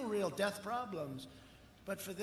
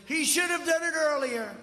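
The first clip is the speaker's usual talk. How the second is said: higher and louder mocking quote; mock complaint; 8 semitones higher, 8 dB louder than usual. Speaker mimics Biden's criticism for the crowd, loud mock. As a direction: belt out the opponent's gripe. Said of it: "and he said".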